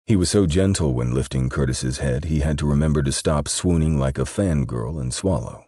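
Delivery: ordinary delivery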